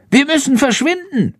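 very high-pitched, urgent bat order; Schandor commanding. A narrator's reading. Schandor orders Jendrik away from the tractor, high. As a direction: give the order in a piping voice. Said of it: "befiehlt Schandor".

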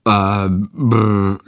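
halting stammer ending in a sputter; boy stuck for the name, stammering; stuttering boy. A narrator's reading. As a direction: stumble over nothing, stuck. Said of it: "Jendrik stottert".

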